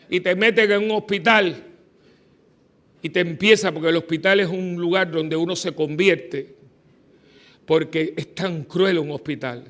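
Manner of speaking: ordinary talk, nothing marked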